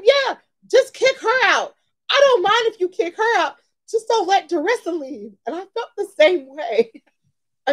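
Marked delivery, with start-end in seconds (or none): 0.0-5.3 much higher, sweet, lightly pleading voice; a sweet friend begging, pitched up high; imitating Tony. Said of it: "Tony is so sweet".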